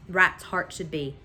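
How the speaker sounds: ordinary delivery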